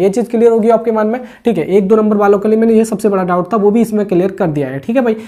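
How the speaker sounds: normal talking voice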